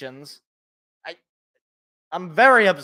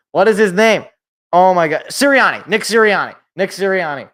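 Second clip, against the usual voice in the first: louder, frustrated blurt as the name finally comes; yelling at your own memory, then the relief of the name; real frustration breaking into a loud shout; exasperated outburst; 2 semitones higher, 10 dB louder than usual. Real, not acted. Finally recalling forgotten coach's name.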